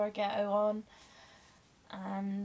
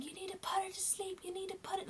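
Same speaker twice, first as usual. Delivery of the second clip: imitating mum's shout; 10 semitones higher, 5 dB quieter than usual. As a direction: voice mum pleading with the anaesthetist, pitched up. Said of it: "literally screaming at the anaesthetist".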